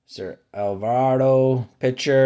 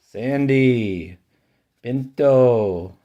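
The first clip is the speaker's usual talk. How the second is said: ordinary delivery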